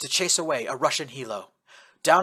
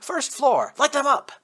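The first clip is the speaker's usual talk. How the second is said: very high, loud command; shouted order; 12 semitones higher, 6 dB louder than usual. Acted. Yamamoto shouting to the BTR driver to open fire.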